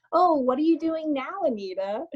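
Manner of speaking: a hint of a condescending friend; mocking nosy friend. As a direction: tease like a judgy acquaintance. Mocking how people question her.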